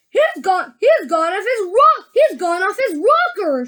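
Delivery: high, loud alarmed shout; aghast shout. A narrator's reading. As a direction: yell in shock. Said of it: "shouted one of the fathers aghast".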